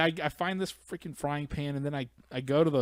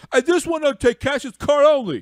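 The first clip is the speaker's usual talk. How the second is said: much higher, louder whine; whiny customer voice; 13 semitones higher, 13 dB louder than usual. Retold or imitated. Customer complaining to the lady, hammed up. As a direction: whine it like a confused man. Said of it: "turns around and goes".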